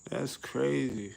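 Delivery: slow, laughing through the word crazy; laughing disbelief. Real, not acted. Laughing at the life sentence, slow amused disbelief. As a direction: let the laugh carry it out slowly.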